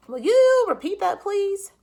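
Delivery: high, loud official voice; a fussy clerk on the line; phone clerk voice. A narrator's reading. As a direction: clerk quizzing Mr Popper by phone.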